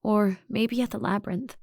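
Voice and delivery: ordinary delivery, nothing marked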